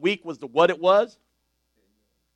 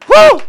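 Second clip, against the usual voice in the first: high, much louder whoop; joyful woo; 16 dB louder than usual. Real, not acted. Preacher celebrating his family in church, joyful whoop.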